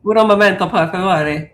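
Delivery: lightly put-on Spanish Italian accent; hammed-up Spanish Italian accent; mock Spanish-Italian accent. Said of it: "Why did you sound Spanish and Italian".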